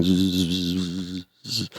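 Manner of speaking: soft imitated wing buzz; quiet repeated zzz; hovering hummingbird. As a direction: buzz softly, hovering in place. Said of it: "looking at me going".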